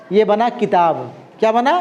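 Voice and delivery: ordinary talk, nothing marked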